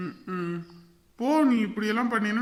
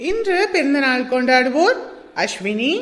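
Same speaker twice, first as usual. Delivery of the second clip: a louder announcer who slows right down on the opening hello; announcer read; 6 dB louder than usual. Acted. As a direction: greet the audience like a show host, loud and proud. Announcer greeting and birthday wishes.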